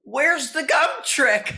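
high, drawn-out mock holler; mock scream. Retelling producers at the fifth audition, screaming out in mock demand. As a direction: call it out slowly, imitating clamoring producers. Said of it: "they screamed out".

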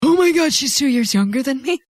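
high, real shocked outburst; shocked disbelief. Real, not acted. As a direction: blurt it out in high-pitched disbelief. Learns actress is younger.

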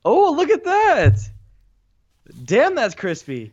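high, slow and loud amazement; a drawn-out, wowed exclamation; impressed. Real, not acted. Admiring friend's fresh haircut.

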